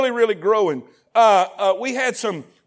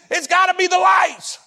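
high, raised mock conclusion; pitched-up parody of their reasoning; mocking exclamation; 8 semitones higher than usual. Mocking churches that copied the lights.

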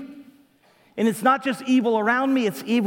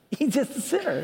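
laughing it off; 4 semitones higher than usual. Shown as a demonstration. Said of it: "you, you laugh".

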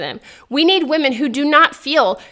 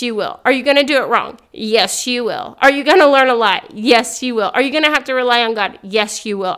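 ordinary delivery

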